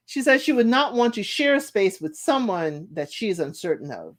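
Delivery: ordinary talk, nothing marked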